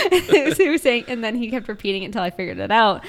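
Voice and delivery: ordinary talk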